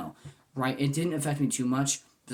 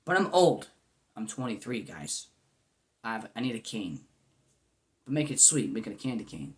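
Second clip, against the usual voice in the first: normal talking voice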